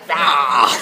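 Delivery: high, loud battle cry; pirate creature shout. Acted. Furry creature challenges the hero to a sword fight, loud high cry. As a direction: yell like a tiny pirate.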